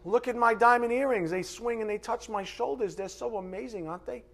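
high, gushing mock New Yorker; New Yorker accent. Preacher mimics a rich show-off flaunting earrings, New Yorker accent. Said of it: "I don't know why I sound like a uh, New Yorker".

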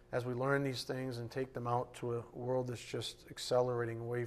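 low, soft, reverent prayer voice; closing prayer. Real, not acted. Pastor closing the evening service in prayer, soft and low. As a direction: pray quietly over the congregation.

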